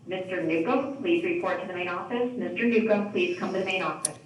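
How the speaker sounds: ordinary delivery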